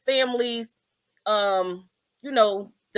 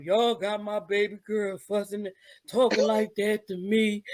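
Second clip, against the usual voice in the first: put-on wounded drunk dad; 4 dB quieter than usual. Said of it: "he was like".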